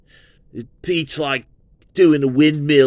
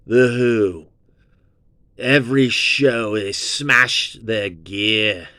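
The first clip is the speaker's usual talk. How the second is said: usual speaking voice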